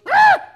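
big high shout of dismay; cartoon Satan shriek; Satan's yelp. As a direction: yelp like the devil just got dunked on. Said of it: "Satan goes".

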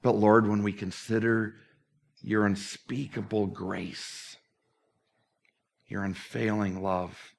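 reverent, slowed closing prayer. Acted. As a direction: pray it gently to close the service.

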